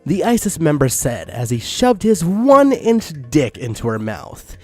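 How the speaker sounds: ordinary talk, nothing marked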